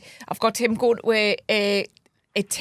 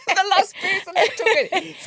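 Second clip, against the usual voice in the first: high laughing explanation, slowed; 9 semitones higher than usual. Real, not acted. Explaining the phrase to a friend, laughing. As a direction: giggle through it.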